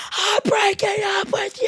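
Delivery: high, drawn-out mock yell; retold yell. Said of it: "was just yelling".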